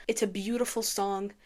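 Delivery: ordinary delivery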